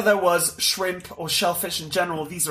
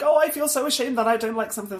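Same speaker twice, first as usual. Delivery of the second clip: mock whiny quote; 7 semitones higher, faster than usual. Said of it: "someone goes like".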